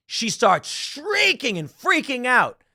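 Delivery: high, drawn-out words, mildly hammed up; mock shrieking. Telling how a woman panicked at a party, mock shrieking. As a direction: stretch the words up high in imitation of her shrieks.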